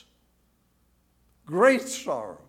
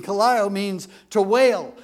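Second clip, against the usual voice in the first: usual speaking voice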